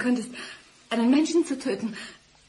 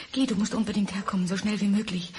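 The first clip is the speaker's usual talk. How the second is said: ordinary delivery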